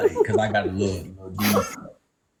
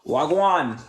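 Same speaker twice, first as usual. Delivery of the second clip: higher, falling Wagwan in a New York-Southern accent; Wagwan in own accent; 5 semitones higher than usual. Shown as a demonstration. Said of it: "can I hear you say Wagwan in your accent".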